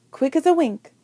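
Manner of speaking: plain reading voice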